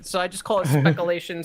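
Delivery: ordinary talk, nothing marked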